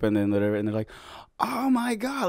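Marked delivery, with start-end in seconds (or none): 1.4-2.3 high-pitched squeal without the volume; mock scream. Girls at the coffee shop spot the dog, mock screaming. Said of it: "Like, they're screaming".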